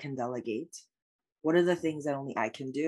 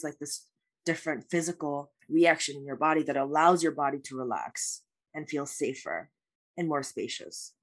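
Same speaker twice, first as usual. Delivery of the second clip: usual speaking voice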